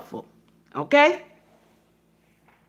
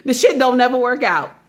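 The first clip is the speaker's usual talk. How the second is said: ordinary talk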